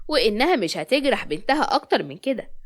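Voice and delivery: plain reading voice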